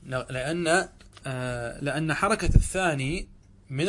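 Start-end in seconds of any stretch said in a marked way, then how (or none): none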